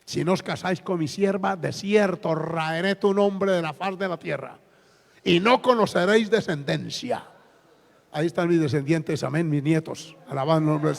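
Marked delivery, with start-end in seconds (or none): none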